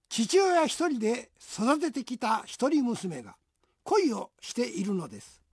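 usual speaking voice

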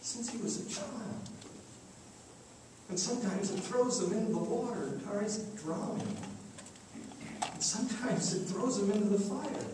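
lightly voiced anguished parent; quiet, understated distress; sorrowful father. Father tells of his son's suffering.

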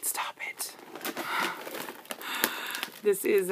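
ordinary talk

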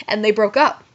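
usual speaking voice

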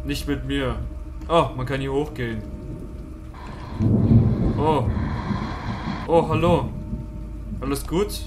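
ordinary talk

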